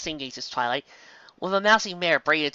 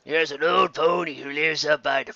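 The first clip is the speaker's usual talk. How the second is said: slow, lightly voiced old pony; nosy interruption; grizzled old stallion; slower than usual. Old stallion overhears and questions Twilight.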